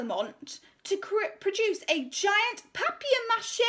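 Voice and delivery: high, slow, self-important voice; pompous art teacher. Acted. Art teacher announcing her decision to the school, pompous and high. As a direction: preen over the assembly. Miss Conceit directs the children.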